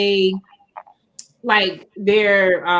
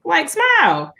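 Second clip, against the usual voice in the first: lightly imitating the worker, pitched up; a friendly, pitched-up nudge to cheer up; mock worker voice, higher and chirpy; high imitated Walmart worker; 9 semitones higher than usual. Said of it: "he was like".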